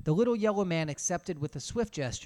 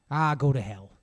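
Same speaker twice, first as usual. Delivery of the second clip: flat dismissal, louder; expressionless; 8 dB louder than usual. Acted. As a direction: brush him off without feeling. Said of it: "in an expressionless voice".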